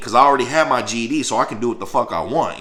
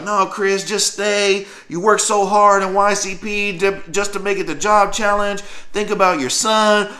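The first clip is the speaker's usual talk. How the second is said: higher, gently pleading mother; a hint of a mom begging him to stay; lightly voiced pleading mom; 8 semitones higher than usual.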